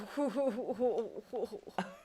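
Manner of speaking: imitated cartoon giggle in a wobbly string; cartoon-character laugh. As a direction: mimic someone laughing like a cartoon. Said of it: "he would laugh like a cartoon character".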